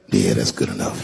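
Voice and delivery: very low, settled mock satisfaction; lazy shrug-off. Comedian on stage to a few laughers, lazily satisfied. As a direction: drop right down, acting easily satisfied.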